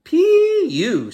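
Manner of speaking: very high, loud nose-pinched squeal; holding her nose. Acted. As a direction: squeal it with your nose held shut. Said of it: "said the mermaid, dropping her book and holding her nose".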